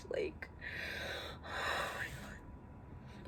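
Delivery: very quiet sigh, then slow whisper; real hushed, emotional exhale; overcome with emotion. Real, not acted. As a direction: whisper it slowly, overwhelmed. Overcome talking about beloved friend.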